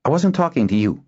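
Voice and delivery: high, testy put-down; irritated rebuff. A narrator's reading. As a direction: shut them out of the quarrel. Heated quarrel between the two.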